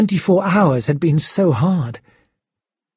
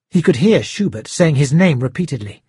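ordinary delivery

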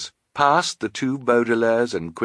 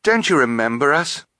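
higher, urgent question; raised, insistent boy's voice; appealing boy; 6 semitones higher, 4 dB louder than usual. A narrator's reading. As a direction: press for recognition. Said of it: "Klaus asked Carmelita Spatz".

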